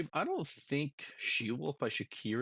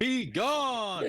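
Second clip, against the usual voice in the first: commanding shout; 10 semitones higher than usual. Acted. Character banishing a foe.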